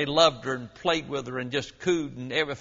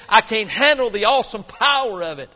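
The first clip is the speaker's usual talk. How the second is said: raised, louder and higher outcry; impassioned preaching cry; 5 semitones higher, 7 dB louder than usual. Retold or imitated. Preacher quotes David overwhelmed by sin's power, impassioned cry. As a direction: cry it out from the pulpit.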